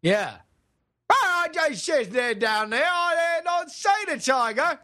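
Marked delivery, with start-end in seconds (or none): 1.1-4.8 slow, excited British caricature, voice pitched up; over-the-top British accent, stretched out; mock British accent. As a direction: crow it in a hammed-up British accent. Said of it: "how British people talk".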